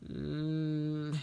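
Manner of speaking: a small weary sighing hum. Acted. James hurt after the crash, soft sighed "mm". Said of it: "sighed James".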